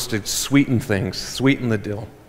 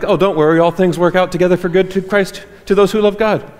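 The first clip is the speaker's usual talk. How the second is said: higher, breezy brush-off of a friend's pain; 7 semitones higher, 4 dB louder than usual. Retold or imitated. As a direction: toss the verse off like a cheap comfort. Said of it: "be like".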